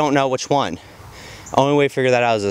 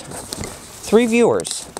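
drawn-out lament; 10 semitones higher, much slower than usual. Acted. Deadpan lament about viewers.

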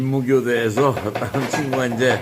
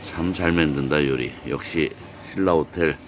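calm, lower narrator voice; documentary narration; 7 semitones lower, 3 dB quieter than usual. Acted. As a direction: narrate the scenery like a travel show. Morning scenery narration.

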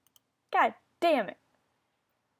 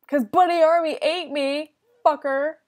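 loud higher furious shout; angry outcry; 3 semitones higher, 11 dB louder than usual. Real, not acted. Gamer shouts in fury at the player who ate them. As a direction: rage at being eaten.

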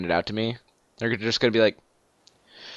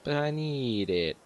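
slow, soft mock whine; mocking echo; 8 dB quieter, much slower than usual. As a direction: parrot the plea back, drawn out and softer. Mocking echo of friend's plea.

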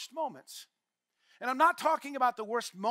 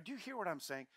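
ordinary talk

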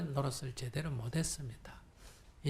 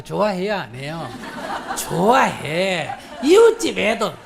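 laughing; 10 dB louder than usual. Real, not acted. Laughing at conditional love.

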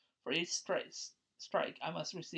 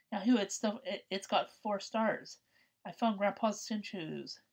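excited; 4 semitones higher, 4 dB louder than usual. Acted. Kid finds Dragon Ball.

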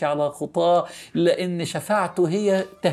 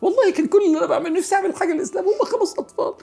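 delighted memory; 11 semitones higher than usual. Retold or imitated. Recalls his joy teaching kids.

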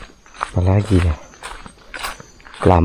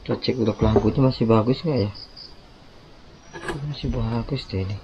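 quiet; 3 semitones higher, 12 dB quieter than usual. Real, not acted. Checking net quietly at night.

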